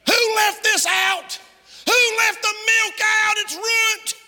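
high, drawn-out mock yell of a woman; scolding shout. Preacher imitating women finding the milk left out, shouting. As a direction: holler the accusation through the house. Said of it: "I can hear the women now".

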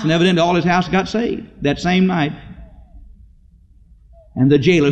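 ordinary delivery

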